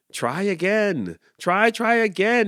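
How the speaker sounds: high, rising pep talk; encouraging. A narrator's reading. Farmer cheering the rooster on, high encouragement. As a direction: cheer him on brightly. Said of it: "encouraged Farmer Kevin".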